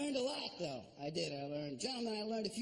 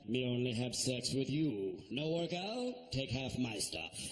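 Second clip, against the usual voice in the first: caveman voice; 8 semitones lower than usual. Retold or imitated. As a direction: grunt it like a simple caveman. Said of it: "my impression of a caveman".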